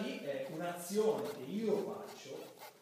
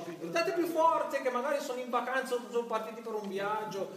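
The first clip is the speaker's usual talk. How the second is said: Elijah taunting; 8 semitones higher, 6 dB louder than usual. Retold or imitated. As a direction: taunt the prophets of Baal to shout louder. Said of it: "e Elia gli dice".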